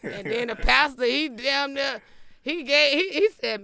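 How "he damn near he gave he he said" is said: normal talking voice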